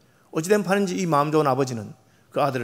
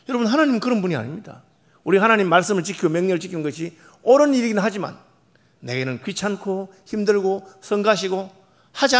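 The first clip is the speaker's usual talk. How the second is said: higher, louder sermon; a pastor preaching hard to his congregation; full-force preaching; 5 semitones higher, 5 dB louder than usual. Acted. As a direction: press the point home to the congregation.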